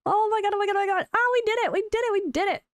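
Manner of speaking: high shrieking joy; shrieking jackpot winner. Real, not acted. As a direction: squeal like you just won. Real shrieking joy at victory.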